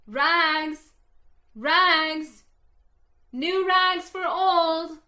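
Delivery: ringing street vendor's call; ragman's call. Acted. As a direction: call it out loud and clear down the alley. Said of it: "calling in a clear tenor voice".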